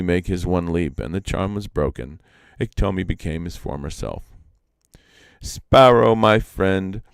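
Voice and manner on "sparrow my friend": louder, higher pleading call; a lifted, loud plea to the sparrow; begging. Acted. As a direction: plead for another chance. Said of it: "begged Iktomi".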